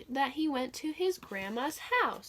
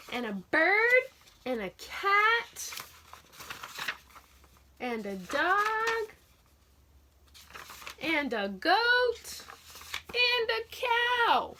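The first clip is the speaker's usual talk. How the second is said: building excitement; 7 semitones higher, slower than usual. Shown as a demonstration. Teacher builds up the animal list.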